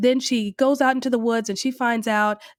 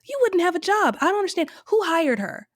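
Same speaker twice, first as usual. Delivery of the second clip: high, baffled disbelief; incredulous; 8 semitones higher than usual. Real, not acted. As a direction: ask in disbelief, pitch up. Incredulous reaction to casting.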